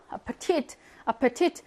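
아파티트 with a reduced 파, stress on 티트; 아파티트 with an unstressed schwa in the middle; weak first e. Shown as a demonstration. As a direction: weaken 파 and land on 티트 in 아파티트. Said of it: "이렇게 발음합니다".

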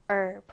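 "herb" said as "erb", no H; "herb" starting straight on the vowel; silent-H herb. Shown as a demonstration. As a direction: drop the H in "herb", American style. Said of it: "H 발음이 없어요".

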